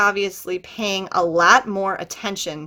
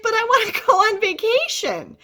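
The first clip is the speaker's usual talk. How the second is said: put-on whiny teen; 12 semitones higher than usual. Teen whining to her dad.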